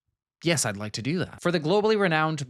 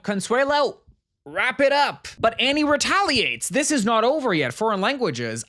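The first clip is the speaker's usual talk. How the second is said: much higher and louder, hyping the battle; hyped narration; 10 semitones higher, 6 dB louder than usual. Retold or imitated. Recapping the speech duel, hyped-up ringside voice. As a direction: call it like a fight announcer.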